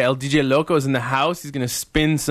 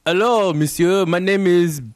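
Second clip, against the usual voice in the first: higher-pitched mock French accent; hammed-up French accent, pitched up; 5 semitones higher, slower than usual. Co-host imagining how a big guest would introduce himself, mock French accent. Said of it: "Does he, like, have a French accent?".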